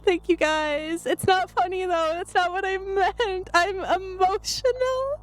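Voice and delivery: tearful, emotional. Real, not acted. Streamer overwhelmed by a friend's gift, tearful and emotional. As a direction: plead through happy tears. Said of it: "I'm bald and I'm crying again".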